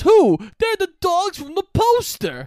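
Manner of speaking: high, loud, hammed-up surprise; over-the-top ranger. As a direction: overact the discovery. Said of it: "a very over-the-top voice".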